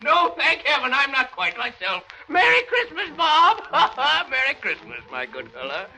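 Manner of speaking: put-on a changed old man bursting with Christmas joy; overjoyed Scrooge. Scrooge reborn, giddy with joy.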